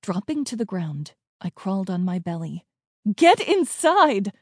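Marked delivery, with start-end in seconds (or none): 3.1-4.3 slow, high, loud command; yell. A narrator's reading. Mom from the upstairs window to her children, urgent yell. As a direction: call it out across a distance. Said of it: "Mom yelled from the upstairs window".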